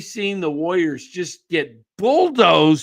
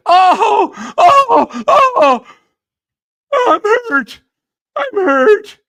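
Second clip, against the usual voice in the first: much higher, louder wailing fake pain; mock whiny crying; 11 dB louder, slower than usual. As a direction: ham up a crybaby faking injury. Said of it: "Look at him fall".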